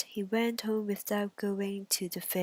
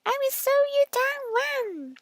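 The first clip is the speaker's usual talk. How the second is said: high squeaky pig voice; eager little pig; piggy asks, much higher; 5 dB louder than usual. Acted. Pig tricks the wolf.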